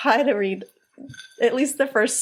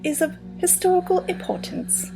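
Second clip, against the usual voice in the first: higher-pitched put-on English accent; Lady Mary; 5 semitones higher than usual. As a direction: do a light, amateurish English accent. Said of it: "that was my shitty, shitty English accent".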